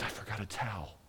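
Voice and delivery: a quiet dismayed murmur; hushed realization. Acted. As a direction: murmur it as a sudden small realization. Mary voiced, realizing her mistake.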